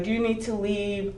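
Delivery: a soft, gentle question to a shaken coworker; hushed concern. Retold or imitated. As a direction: manager quietly asks if she needs to go home, softly. Manager quietly checking on her.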